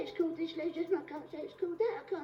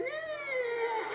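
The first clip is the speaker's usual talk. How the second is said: put-on snotty kid's sigh; 9 semitones higher than usual. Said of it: "he was going".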